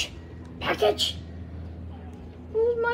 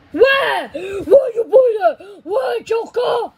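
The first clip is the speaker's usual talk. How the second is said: loud, slow superhero interrogation; slow, forceful hero-style shouting; Batman; 3 semitones higher, 6 dB louder, slower than usual. Acted. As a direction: shout each demand like an interrogating vigilante. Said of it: "Are you copying Batman?".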